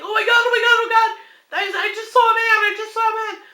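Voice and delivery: high, loud frightened babble; terrified wife waking her husband; panicked. Retold or imitated. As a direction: blurt it out in a panic to wake your husband. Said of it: "I'm like".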